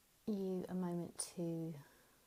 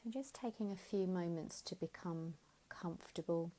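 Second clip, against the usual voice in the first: guided meditation. Acted. Teacher guiding listeners lying down, gentle guided meditation. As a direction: ease them into stillness, unhurried. Leads a Yoga Nidra relaxation.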